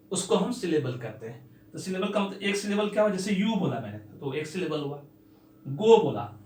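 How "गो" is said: "गो" said loudly in one beat; single syllable. Shown as a demonstration. Teacher showing a one-syllable word, गो in one loud beat. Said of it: "एक बार में बोला".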